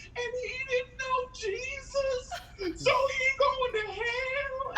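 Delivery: very high, drawn-out comic wail; mock sobbing child. Comic imitating a little girl whose dog died, wailing. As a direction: bawl it slowly like a heartbroken little girl. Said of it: "this little girl, uh, she started crying".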